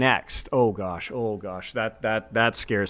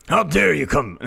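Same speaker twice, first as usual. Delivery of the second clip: strained Soldier voice; 3 dB louder than usual. Shown as a demonstration. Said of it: "he sounds constipated".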